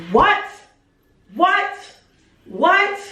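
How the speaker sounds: high, stunned rising what; can't believe what she just heard; shocked what. Real, not acted. Hearing about the bathroom, high, shocked disbelief.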